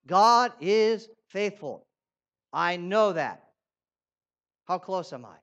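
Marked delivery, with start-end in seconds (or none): 0.1-3.4 slow, loud declaration that God is faithful; confident confession. Acted. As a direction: proclaim it with certainty.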